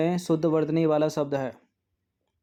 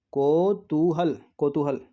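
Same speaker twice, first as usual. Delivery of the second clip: slow; slower than usual. Shown as a demonstration. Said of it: "word stretched while writing".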